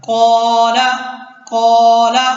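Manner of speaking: "कौला" with a heavy, rounded long "कौ"; loud, held, full-mouthed first vowel in "कौला"; thick alif. Shown as a demonstration. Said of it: "अलिफ भी मोटा पढ़ा जा रहा है".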